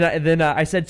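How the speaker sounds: ordinary talk, nothing marked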